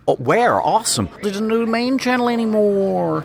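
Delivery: high-pitched, hammed-up American accent; caricatured American fan accent. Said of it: "But I'd have to do an American".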